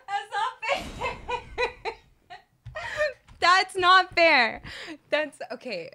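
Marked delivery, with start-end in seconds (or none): 0.1-1.9 high, laughing complaint; laughing protest. Real, not acted. Laughing while protesting game death. 3.4-4.6 loud, high, slow complaint; yelled protest. Real, not acted. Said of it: "Okay, I'm yelling".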